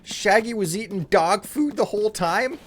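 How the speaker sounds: high-pitched goofy cartoon slacker; Shaggy. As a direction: puzzle aloud in a high cartoon stoner voice. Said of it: "Is this the right way to do Shaggy from Scooby-Doo".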